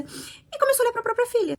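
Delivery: high, shocked outcry on começou; shocked emphasis. Real, not acted. Telling her audience of the father's turn to the daughter, shocked and high. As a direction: reviewer voicing her disbelief at the twist, high and stunned. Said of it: "É isso, gente".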